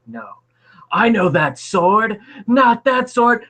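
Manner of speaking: a demon recognising its doom; panicked demon. Acted. GM voicing the demon as the holy sword comes, panicked. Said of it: "He's like".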